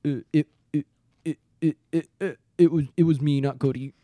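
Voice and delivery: low, stuck on the first word, pauses between; reluctant halting confession. Retold or imitated. Friend mimics Bobby confessing to dad, halting stammer. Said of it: "finally musters up the balls".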